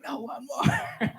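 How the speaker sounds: very high, slow, drawn-out retort; mock insistence. Dad to wife about another climb, comic high-pitched insistence. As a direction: stretch it out as a comic stubborn dad.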